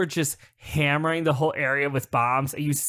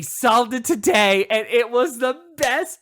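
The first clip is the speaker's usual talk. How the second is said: raised, emphatic delight; thrilled, can hardly contain it; excited announcement; 6 semitones higher, 4 dB louder than usual. Real, not acted. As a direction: build to a triumphant high finish. Thrilled at solved mystery.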